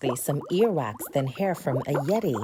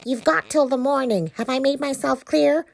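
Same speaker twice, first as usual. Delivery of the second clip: menacing witch; 8 semitones higher, 6 dB louder than usual. Acted. Witch threatens Chris.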